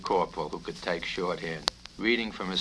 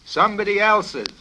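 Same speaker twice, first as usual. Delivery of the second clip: shouting colonel; 7 semitones higher, 10 dB louder than usual. Acted. Colonel shouting at the stenographer corporal, loud. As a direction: yell the correction. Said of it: "the colonel shouted".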